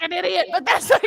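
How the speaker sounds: high, loud words through laughter; laughing helplessly. Real, not acted. Guest embarrassed on camera, laughing helplessly. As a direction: laugh at yourself as you say it. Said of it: "I've just been laughing the entire time".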